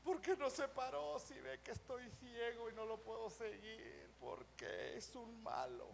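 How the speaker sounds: soft, whiny mock grievance; mock sulking. Mimics a sulking blind follower.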